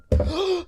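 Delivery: sudden high-pitched intake of breath; real sharp gasp of alarm; startled gasp. Real, not acted. Streamer almost spilling water, startled gasp.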